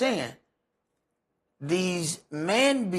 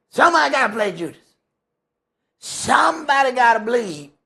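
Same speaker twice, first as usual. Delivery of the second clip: louder, higher, fast driving sermon; shouting preacher on who must play Judas; preaching at full force; 4 semitones higher, 5 dB louder than usual. Acted. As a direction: hammer the point home to the church.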